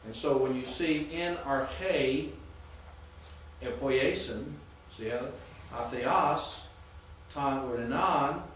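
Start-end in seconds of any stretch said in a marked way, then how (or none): 1.1-4.4 "in arche, epoiesin" slowly, accented syllables punched; emphasis on accented syllables. Shown as a demonstration. Teacher showing students how Greek reads, emphasis on accented syllables. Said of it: "see where the emphasis goes". 5.7-8.4 "atheos, ton urinan" slow, accents hit firmly; emphasis on accented syllables. Shown as a demonstration. Teacher demonstrating Greek accents to class, emphasis on accented syllables. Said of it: "see where the emphasis goes".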